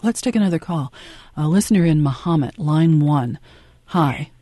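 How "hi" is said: ordinary delivery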